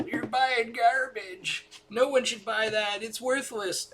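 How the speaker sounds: high, very slow mocking voice of naysayers; hammed-up sneering critic voice. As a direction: parody the people who scoffed at him. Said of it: "people used to yell at me and go".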